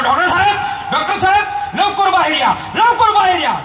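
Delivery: high, urgent cry for the doctor; acted frantic calling. Panicked patient calls the doctor outside, frantic calling.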